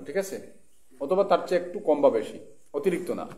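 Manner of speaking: normal talking voice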